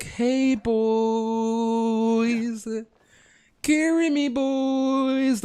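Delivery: put-on very high, slow coaxing girly voice; a guy begging teammates in a girly voice; fake girl voice. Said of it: "pretending to be a freaking girl".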